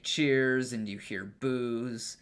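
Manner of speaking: ordinary delivery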